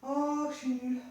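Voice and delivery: normal talking voice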